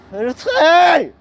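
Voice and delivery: sudden high yell of alarm; shout. Retold or imitated. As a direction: yell it in panic. Shouting warning in car. (cri d'alarme)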